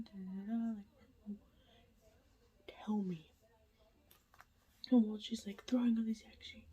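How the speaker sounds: hushed late-night whisper to the camera; whispered update. Real, not acted. Vlogger whispering a reading update at night, hushed whisper. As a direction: keep it all breathy and hushed so nobody wakes.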